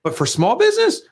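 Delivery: ordinary talk, nothing marked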